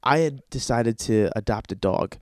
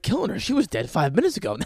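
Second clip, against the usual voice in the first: high query dropping to usual voice; incredulous retort; 6 semitones higher, faster than usual. Acted. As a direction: answer the panic with disbelief. Teller's dry answer to screaming girlfriend.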